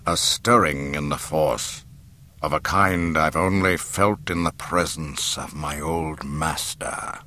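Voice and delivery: low, slow ominous dark lord; Darth Vader. A narrator's reading. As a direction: speak slowly from deep down. Vader answers Tarkin.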